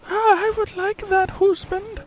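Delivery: put-on old wife falsetto. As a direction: squeak it like a doting old wife. Acted couple dialogue.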